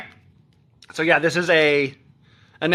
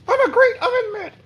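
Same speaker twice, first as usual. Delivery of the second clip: much higher puppet voice for the oven mitt. Pretend. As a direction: make the oven mitt talk. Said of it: "if I did voices or whatever".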